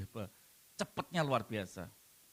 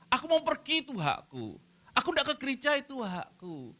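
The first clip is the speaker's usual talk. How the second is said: entitled voice; 10 semitones higher than usual. Retold or imitated. Mocking a self-entitled believer.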